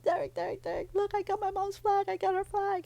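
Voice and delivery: high, sing-out calling of a name; mock yelling with thrilled urgency; very high, excited imitation of calling out; re-enacted excited yell. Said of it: "I yelled".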